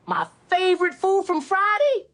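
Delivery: high squeaky Chris Tucker pitch, loud; Chris Tucker impression. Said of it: "do your Chris Tucker impression".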